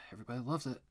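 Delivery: dry sarcastic stress on loves. Acted. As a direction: say loves with mock enthusiasm. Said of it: "I am being sarcastic".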